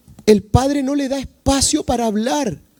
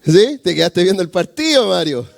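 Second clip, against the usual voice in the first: mock scolding; 8 semitones lower, faster than usual. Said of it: "le decimos".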